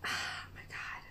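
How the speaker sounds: whispered under breath. Real, not acted. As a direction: breathe it out unvoiced. Tense moment before answer.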